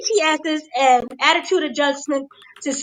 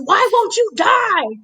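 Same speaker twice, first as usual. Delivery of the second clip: high, slow, drawn-out mock yell; mock scream; 5 semitones higher, 3 dB louder, slower than usual. Fan recalls wrestler's attack on Edge, mock scream. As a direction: imitate an exasperated scream, stretching the words. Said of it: "he screams".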